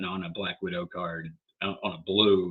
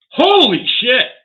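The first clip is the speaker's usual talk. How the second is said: real loud, high, slow shout of disbelief; shocked cry; 13 dB louder, slower than usual. Real, not acted. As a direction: blurt it out, stunned by the grade. Shocked by a great card grade.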